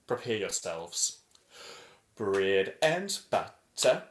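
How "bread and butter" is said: very slow, drawn-out chant; super slow. Shown as a demonstration. Showing the class super duper slow chanting. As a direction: stretch every word out slowly. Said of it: "super duper slow".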